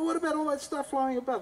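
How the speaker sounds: very high, loud protest; incredulous outcry. Retold or imitated. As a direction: act out an alarmed, squeaking objection. Acted outcry about the flies.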